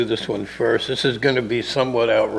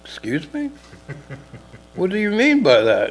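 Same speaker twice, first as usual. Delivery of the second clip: surprised chief; 7 semitones higher than usual. Retold or imitated. Chief reacts to mayor's hint.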